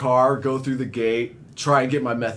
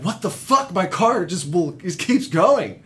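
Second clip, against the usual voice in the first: higher, alarmed retold yelp at the runaway car; a touch of mock-panicked disbelief, pitched up; panicked outburst; 5 semitones higher than usual. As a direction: replay the panic of a car that won't stop.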